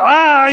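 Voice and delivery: grito imitado, agudo y fuerte. Retold or imitated. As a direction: suelta un grito de dolor actuado. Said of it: "empieza a gritar". (alarido de papá, en imitación)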